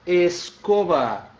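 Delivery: "Escova." said slowly with falling end; slow careful Spanish word. Shown as a demonstration. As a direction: stretch out "Escova." syllable by syllable. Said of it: "Cuidado com essa palavrinha".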